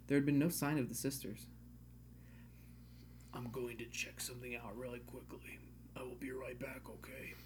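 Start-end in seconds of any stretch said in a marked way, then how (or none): 3.3-7.3 soft whispered line. Acted. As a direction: hush it under your breath. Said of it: "he said, I called out".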